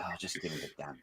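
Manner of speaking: low, defeated mumble to himself; muttered aside. Retold or imitated. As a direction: mutter it under your breath, fed up. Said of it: "I was like".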